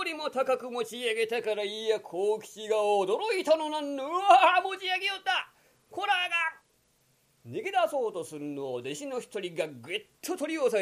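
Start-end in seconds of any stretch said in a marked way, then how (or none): 4.1-6.6 high shocked yelp; shocked cry. Acted. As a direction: gasp in alarm. Kokichi shocked. (驚きの叫び)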